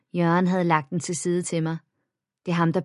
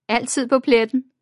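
laughing; 6 semitones higher, 5 dB louder than usual. A narrator's reading. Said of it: "grinede Manke".

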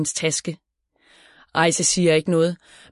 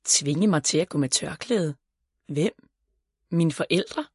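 puzzled, slightly subdued questioning; confused; 4 dB quieter than usual. A narrator's reading. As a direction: repeat it back in mild bafflement. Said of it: "spørger Ejse forvirret".

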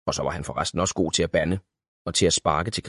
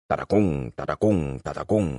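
lower, rhythmic gallop sound; galloping chant; 4 semitones lower than usual. A narrator's reading. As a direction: drum out the hoofbeats.

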